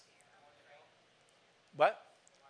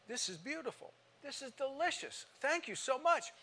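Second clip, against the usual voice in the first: gushing praise; 10 semitones higher, 5 dB quieter than usual. Retold or imitated. Preacher praising hostess's cooking.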